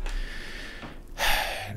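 long, soft exhaled sigh. Real, not acted. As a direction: let out a slow, voiceless sigh. Pauses over a difficult topic.

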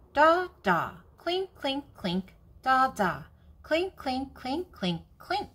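high, bouncy mock clinking; playful cup sounds. Grandma reading, child making her cup go clink, playful and high. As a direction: tap it out like a spoon on a cup.

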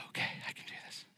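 hushed whisper to yourself; a whispered okay, finding courage; whispered resolve. Acted. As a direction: whisper it to steady your nerve. Self-encouragement after fear.

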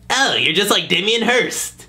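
ordinary talk, nothing marked

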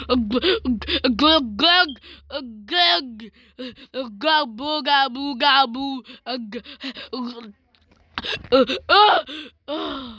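mock gargling and gulping; loud, playful drowning glugs; drowning noises. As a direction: play like you're going under the water. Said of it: "I was gonna drown myself".

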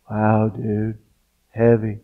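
low, soft laid-back dude voice; stoner dude aside. Pretend. Reader mocks the lecture with a low lazy dude voice. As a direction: drop into a lazy surfer voice.

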